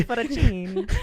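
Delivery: loud, drawn-out mock Italian accent. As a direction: ham up the Italian, slowly and loudly. Joking Italian accent showing off.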